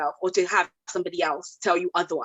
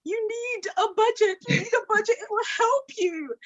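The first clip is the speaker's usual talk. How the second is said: 1 mock shouting; 14 semitones higher than usual. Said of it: "I'm just here always shouting".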